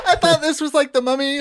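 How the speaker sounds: high mock child voice through laughter; six-year-old. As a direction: play a puzzled little kid, giggling. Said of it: "some six-year-old".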